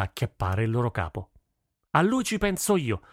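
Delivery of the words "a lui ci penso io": high, forceful battle call; narrated rallying shout; shouted to the troops. A narrator's reading. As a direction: call out a command to your army. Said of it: "urlò al suo esercito".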